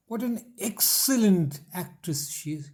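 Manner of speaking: slow exclamation climbing high on "excellent", then falling; admiring model sentence with exaggerated pitch rise on "excellent"; rising-falling intonation. Shown as a demonstration. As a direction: lift the voice into "excellent" and let it drop after. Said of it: "आवाज़ कहाँ ऊपर जाएगी, कहाँ नीचे आएगा".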